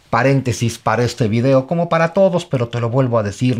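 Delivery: normal talking voice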